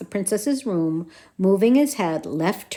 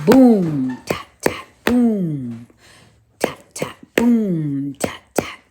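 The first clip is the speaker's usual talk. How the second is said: drum imitation; 4 semitones lower, 3 dB louder, slower than usual.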